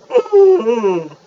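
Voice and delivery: chewing while humming; humming through a mouthful; mouth full. Real, not acted. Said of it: "talk with my mouth full".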